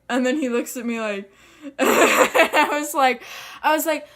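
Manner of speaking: normal talking voice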